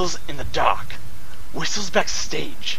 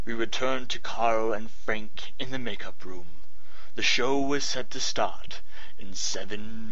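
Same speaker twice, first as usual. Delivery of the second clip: low, slow welcome-back read; low, unhurried announcer voice; radio announcer; 7 semitones lower, slower than usual. Acted. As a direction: ease the audience back into the story.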